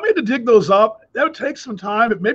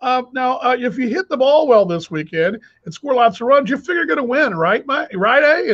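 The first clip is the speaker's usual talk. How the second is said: higher mock naive interviewer, upbeat eh at the end; imitating the clueless interviewer; 5 semitones higher than usual. Host mocking an Australian interviewer's obvious question, higher mock voice. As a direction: play the clueless host fishing for a yes. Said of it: "and I'll do the imitation".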